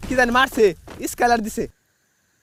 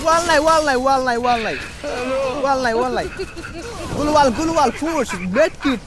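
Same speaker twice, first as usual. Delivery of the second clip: urgent yells, higher; panicked shouting; 5 semitones higher, 6 dB louder, faster than usual. Real, not acted. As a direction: shout the warning again and again. Panicked warning mid-fight.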